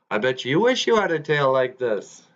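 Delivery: high, taunting brag; boastful beaver. Acted. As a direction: lord it over the bear, pitch lifted. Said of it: "And Mick says".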